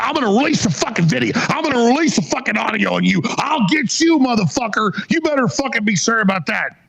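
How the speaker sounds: high-pitched furious tirade; real outburst of rage; angry threat. Real, not acted. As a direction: threaten them, voice climbing high. Host's angry threat at his opponents.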